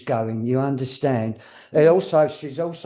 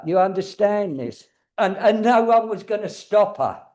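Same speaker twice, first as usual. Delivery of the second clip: usual speaking voice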